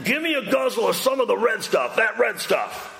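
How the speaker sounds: gruff hungry brute grabbing food; brutish Esau. Retold or imitated. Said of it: "give the best translation I can".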